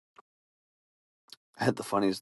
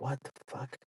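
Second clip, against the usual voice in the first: softer, pitched-up muttered disbelief; muttered what the fuck; 6 semitones higher, 13 dB quieter than usual. Retold or imitated. Coworker finding his Tupperware emptied, muttering softer. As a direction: mutter it under your breath, baffled. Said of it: "and you're like".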